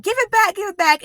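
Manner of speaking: high, loud snapping demand; angry demand. Retold or imitated. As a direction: yank it back in a high, loud voice. Tussling with husband over magazine.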